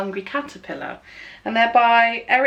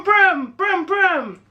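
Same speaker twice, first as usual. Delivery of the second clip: higher, buzzing brum brum; car engine noise; 7 semitones higher, slower than usual. Shown as a demonstration. Teacher makes a car noise for the children, higher and buzzing. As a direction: rev like a toy car. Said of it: "Sometimes it goes brum".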